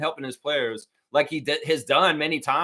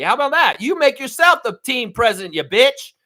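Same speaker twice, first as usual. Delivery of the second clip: furious rant; 9 semitones higher, 7 dB louder than usual. Real, not acted. Fan yelling at Keith Pelley on the broadcast, furious. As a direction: pitch up, louder, spitting it out. Ranting at the team owner.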